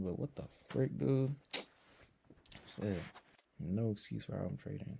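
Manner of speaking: usual speaking voice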